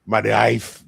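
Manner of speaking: put-on German accent. Imitating German coworker's my wife. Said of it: "That's what he says".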